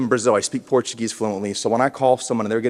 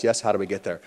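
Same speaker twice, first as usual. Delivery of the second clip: ordinary delivery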